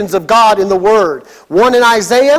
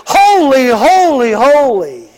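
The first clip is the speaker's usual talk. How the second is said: seraphim crying out. Acted. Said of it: "They're crying out to each other".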